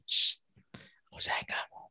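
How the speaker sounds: soft whispered sweet nothings; hushed lover's whisper. Shown as a demonstration. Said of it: "ngomongnya bisik-bisik".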